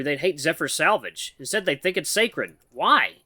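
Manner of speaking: ordinary delivery, nothing marked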